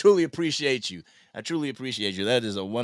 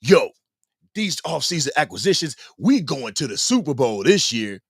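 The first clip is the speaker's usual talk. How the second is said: mock overconfident boast; 3 semitones higher than usual. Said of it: "I'm not gonna say".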